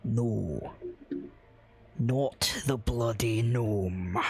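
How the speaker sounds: low, slow, irritated dwarf; the dwarf brushing off a wrong guess; old dwarf correcting the stranger. Acted. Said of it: "do the voice".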